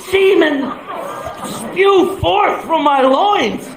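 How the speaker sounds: high, slow orgasmic wail; mock ecstasy. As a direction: draw each word out in hammed-up rapture. Acts out climax while frightened.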